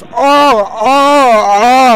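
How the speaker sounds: high, very loud imitated moans; loud mock moaning. Classmate making noises from the bathroom, loud fake moaning. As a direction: moan loudly, hammed up.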